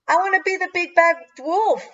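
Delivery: put-on high, eager child's call. A narrator's reading. Tracy claiming the wolf role, calling out. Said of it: "Tracy called out".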